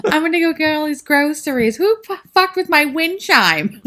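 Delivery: high, laughing put-on voice of a fussy aunt; mocking Aunt Kate. Mocking the aunt in a podcast recap, in a high put-on voice. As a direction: ham up the dotty aunt, giggling. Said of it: "she was just like".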